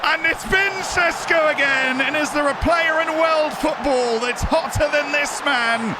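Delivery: high-pitched, full-voiced commentator call; high, thrilled play-by-play; excited goal commentary. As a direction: shout it like a commentator after a goal. Calling his goal like commentator.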